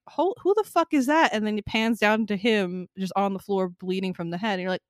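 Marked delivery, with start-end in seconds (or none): 0.1-1.3 startled. Retold or imitated. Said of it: "I'm like".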